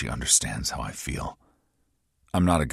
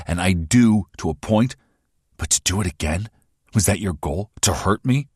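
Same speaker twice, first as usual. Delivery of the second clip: louder and higher, hurt fury; angry outburst; 5 semitones higher, 6 dB louder than usual. Acted. Hero rages at Erin over her secret, louder and higher. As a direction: confront her for hiding it. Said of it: "I'm pissed".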